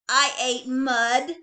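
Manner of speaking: slow, loud sulky little bunny; whiny unhappy kid; unhappy child. A narrator's reading. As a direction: drag it out, sulking. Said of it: "answered unhappily".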